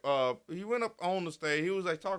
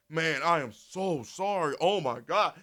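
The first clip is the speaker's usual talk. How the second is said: slow, overdone mock apology, louder at the end; mock sorry apology; 3 dB louder, slower than usual. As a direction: ham up a fake heartfelt sorry. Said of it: "they not finna respond with".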